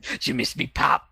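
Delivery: half-whispered sly henchman voice; a hushed, sneaky villain taunt; Zipper voice. Acted. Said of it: "since I've done the Zipper voice".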